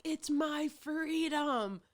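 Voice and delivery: high, slow whiny mock voice; mocking anti-masker. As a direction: whine it like a smug anti-masker. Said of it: "they're like".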